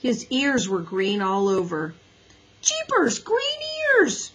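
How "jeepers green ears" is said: very high amazed squeal; high, delighted astonished cry. A narrator's reading. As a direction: squeal with wonder at the sight. Henry amazed at mirror.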